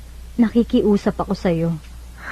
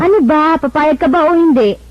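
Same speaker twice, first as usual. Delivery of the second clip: higher and louder, pushing for an answer; impatient demand; 6 semitones higher, 5 dB louder than usual. Acted. Ivy to Vincent, demanding he agree now. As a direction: corner him, impatient.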